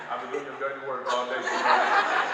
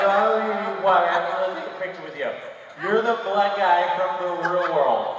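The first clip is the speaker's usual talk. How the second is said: put-on drunk insistence; 4 semitones higher, 6 dB louder than usual. Drunk woman insists loudly.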